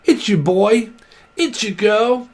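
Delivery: put-on a high, bright-pitched 'it's your boy' parody; mock vlogger intro. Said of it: "They start off like".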